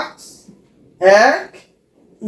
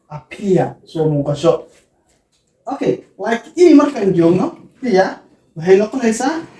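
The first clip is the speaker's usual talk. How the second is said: ordinary talk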